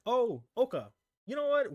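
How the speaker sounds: high, sharp sibling voice bossing Oka; sibling's pushy demand. Retold or imitated. Sibling bossing Oka around, high and pushy. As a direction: mimic the sibling cornering her. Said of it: "they're like".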